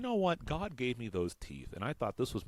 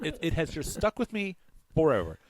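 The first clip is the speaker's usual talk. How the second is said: ordinary talk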